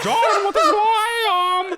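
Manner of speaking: slow, very high catchphrase shriek; mock exclamation. Rejecting the caller's story, shrieked mock-outraged catchphrase. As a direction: wail it out, nearly laughing. Exasperated catchphrase while laughing.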